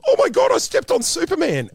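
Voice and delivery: very high, loud squeal; imitated panicked shriek. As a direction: shriek in horrified starstruck panic. Retold shriek of panicking booth helper.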